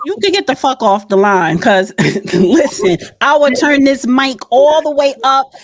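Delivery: loud, furious telling-off of a caller; angry yelling. Real, not acted. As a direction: blast him off the line. Host blasting a rude caller.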